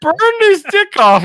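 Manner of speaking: very high squeal while laughing; excited. Real, not acted. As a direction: shriek the punchline through laughter. Laughing retelling of joke.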